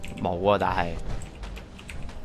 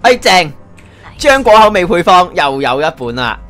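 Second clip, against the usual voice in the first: excited; 6 semitones higher, 6 dB louder than usual. Real, not acted. Finds a recipe book.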